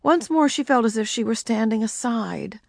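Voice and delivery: usual speaking voice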